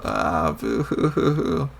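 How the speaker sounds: hammed-up boo-hoo. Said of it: "You're going to make me cry too".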